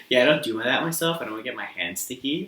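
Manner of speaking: low, soft mock customer voice. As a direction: mumble it low like the fussy customer. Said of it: "that sounds so funny".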